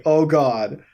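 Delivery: ordinary delivery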